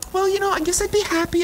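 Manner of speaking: a hammed-up shrug of an answer; mock unsure reply. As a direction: imitate a timid, unconvinced client. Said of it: "people are like".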